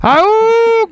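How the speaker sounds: high, loud ahooga horn noise. Pretend. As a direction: honk it like an old car horn. Horn-like sound effect.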